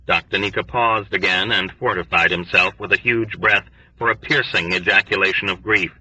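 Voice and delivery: ordinary delivery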